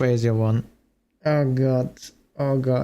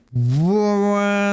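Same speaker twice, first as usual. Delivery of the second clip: shocked; 7 semitones higher than usual. Real, not acted. Shocked reading allegations.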